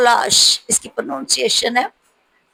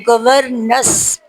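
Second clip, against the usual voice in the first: slow repeat; much slower than usual. Shown as a demonstration. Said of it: "teacher repeating word slowly".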